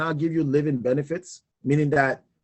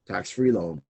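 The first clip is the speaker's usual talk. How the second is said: deep low repeat; 9 semitones lower, 4 dB quieter than usual. Shown as a demonstration.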